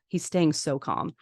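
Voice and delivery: ordinary talk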